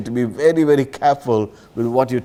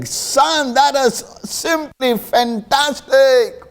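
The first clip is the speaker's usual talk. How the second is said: high, slow, shouted praise; enthusiastic shout; 10 semitones higher, slower than usual. Retold or imitated. Father shouting praise at his dribbling son, high and slow. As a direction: shout it out like a dad bursting with pride. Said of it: "He will shout with enthusiasm".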